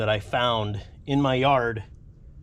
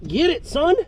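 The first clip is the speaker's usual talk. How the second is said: cheering; 7 semitones higher than usual. Real, not acted. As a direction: egg it on like a proud coach. Cheering as the part moves.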